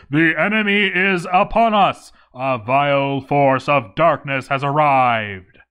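booming mock-epic announcement, slowing on the second line; dramatic herald's alarm. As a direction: cry the alarm like a doom-laden herald. Reading the game's siege alert.

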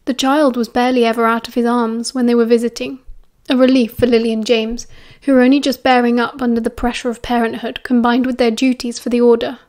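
plain reading voice

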